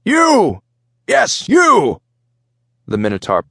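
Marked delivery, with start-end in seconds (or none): 0.1-2.0 very high, loud shout; yelling commander. A narrator's reading. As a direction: bellow at the boy like a Minotaur captain. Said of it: "the Minotaur ship commander yelled at the boy".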